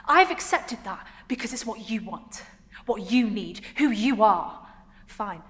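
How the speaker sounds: loud, exasperated concessions; acted indignation, hitting "you" hard; angry. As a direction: list each grievance forcefully, with pauses. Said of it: "remembers how angry she is at what Adrian said".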